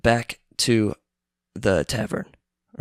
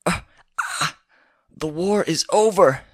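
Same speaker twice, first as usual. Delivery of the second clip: hammed-up dying gasp; 8 semitones higher than usual. Man shot, dying words.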